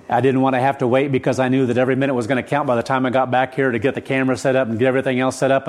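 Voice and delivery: rapid, adrenaline-driven explanation; speaking really fast. Real, not acted. Preacher explaining his hurried drive back, speaking really fast. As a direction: rattle it off in a rush. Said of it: "I'm speaking really fast today".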